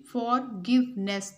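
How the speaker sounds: "फॉरगिवनेस" in three separated beats; syllable by syllable. Shown as a demonstration. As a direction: break "फॉरगिवनेस" into for-give-ness. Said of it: "divide the given word into their syllables". ("फॉरगिवनेस" को तीन हिस्सों में तोड़कर)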